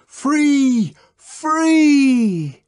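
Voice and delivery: high, loud triumphant shout. A narrator's reading. Savage shouting freedom at the rioting crowd. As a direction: cry it out to the crowd. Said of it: "the savage shouted".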